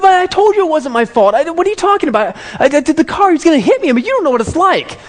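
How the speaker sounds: very high, loud mock protest; indignant teenager. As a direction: argue back like an outraged teen. Son argues back at father.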